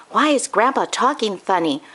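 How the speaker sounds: plain reading voice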